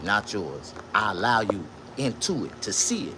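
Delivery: usual speaking voice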